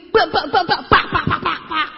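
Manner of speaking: high, choppy string of syllables; rapid-fire babble imitation. Speaker showing how he used to present, high choppy babble. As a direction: imitate nonstop pitching in punchy bursts. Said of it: "ngomongnya kayak begini".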